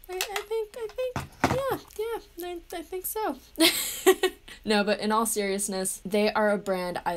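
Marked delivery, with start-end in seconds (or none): 0.1-3.4 high squeaky coy voice. Pretend. Coy mock denial of liking. 3.6-4.6 light laugh after a joke. Real, not acted. Laughs after coy bit.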